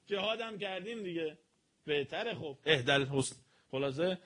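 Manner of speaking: ordinary talk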